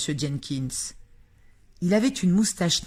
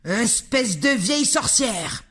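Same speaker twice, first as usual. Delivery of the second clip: louder, slow furious insult; bellowing; 6 semitones higher, 5 dB louder, slower than usual. A narrator's reading. Mr Jenkins yelling insults at grandmother, louder and furious. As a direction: spit the insult at her. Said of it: "vociféra monsieur Jenkins".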